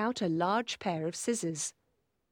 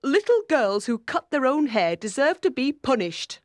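higher, louder indignant aunt; severe, raised reproach; stern scolding; 5 semitones higher, 6 dB louder than usual. A narrator's reading. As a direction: pronounce judgement on a naughty child. Aunt scolds Maggie's haircut.

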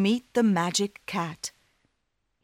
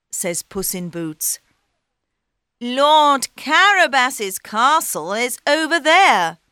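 cat voice; 6 semitones higher, 6 dB louder than usual. Acted. Cat plans.

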